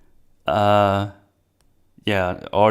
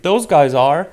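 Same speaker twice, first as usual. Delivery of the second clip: Korg tattling; 8 semitones higher, 8 dB louder than usual. Retold or imitated. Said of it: "And Korg was like".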